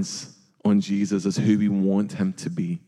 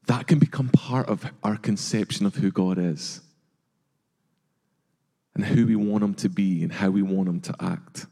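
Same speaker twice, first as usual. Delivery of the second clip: ordinary talk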